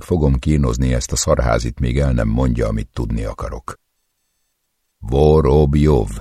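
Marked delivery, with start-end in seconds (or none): 5.0-6.1 Vorobjov spelled out slowly and louder; slow syllabified. Acted. Torturer repeats the name, slowly syllabifying.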